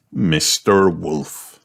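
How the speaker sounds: plain reading voice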